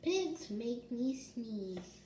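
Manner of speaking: ordinary delivery, nothing marked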